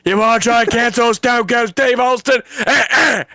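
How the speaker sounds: swaggering Texan wrestler taunt; Stone Cold impression. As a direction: cut a heel promo as Stone Cold.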